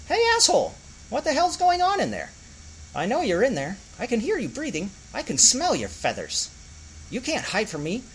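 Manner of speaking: high, ranting little squirrel; a tiny creature furious and shouting; high-pitched squirrel tirade; angry squirrel voice. Acted. Squirrel rants at Clancy.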